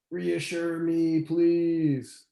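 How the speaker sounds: slow, pleading "Reassure me, please"; pleading energy. Retold or imitated. To the other person, wanting reassurance: slow pleading. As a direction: drag it out like a needy plea. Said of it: "but the energy is like".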